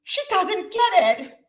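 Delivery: imitating mother-in-law. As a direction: put on a worried older woman's voice, drawn out. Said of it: "And she goes".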